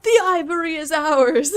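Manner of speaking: loud, high mimicry of a game character; exaggerated, high-pitched battle-cry imitation; mock shout. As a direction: belt it out as a hammed-up echo. Echoes game character's line, loudly.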